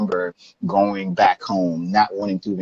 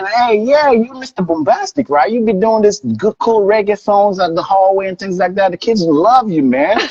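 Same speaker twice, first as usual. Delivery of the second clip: put-on high-pitched, louder hyped-up kid; excited admirer; 12 semitones higher, 4 dB louder than usual. Kid praising his reggae songs in the hallway, retold excitement. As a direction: gush as an imitated schoolkid fan. Acting the admiring kid's reply.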